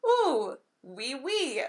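high, slow, hammed-up French accent; bad French accent. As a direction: overdo a fake French accent, drawn out. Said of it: "supposed to be a French accent".

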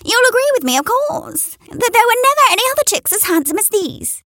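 high, smug hen voice; proud hen. A narrator's reading. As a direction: insist they agree. Henrietta boasting of chicks.

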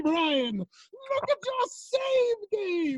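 high, slow villain yell; ominous shout. Acted. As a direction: bellow the threat slowly. Villain taunts about save.

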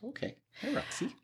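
high soft voice for a pet. Real, not acted. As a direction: coo softly at the dog. Greeting the dog.

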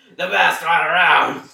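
loud, slow yell; shouting. Acted. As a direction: yell it out triumphantly. Shouted repeat.

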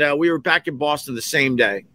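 ordinary delivery

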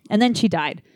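usual speaking voice

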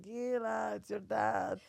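Israeli dad leaving a voicemail; Israeli-accented phone message. Retold or imitated. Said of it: "Israeli accents, like, woof".

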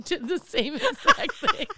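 ordinary talk, nothing marked

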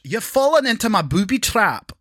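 mock Scottish accent. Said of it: "and finally, Scottish".

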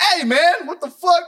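startled high-pitched protest; indignant outburst. Acted. Man hit by a football drops the act, indignant. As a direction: jump up high in sudden indignation. Acting someone snapping out of act.

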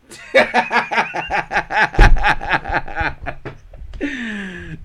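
long high-pitched burst of laughter; loud laugh. Real, not acted. Friend cracking up at a joke about a good-looking neighbor, loud laugh.

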